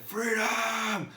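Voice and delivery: high ringing shout; high battle-cry shout; rallying cry. Retold or imitated. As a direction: yell it like rousing a stadium. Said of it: "Tony Robbins went".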